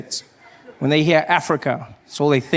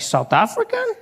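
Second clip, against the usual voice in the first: very high astonished squeal; be amazed he's African; laughing mock surprise; shocked disbelief; 6 semitones higher than usual. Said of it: "He's like".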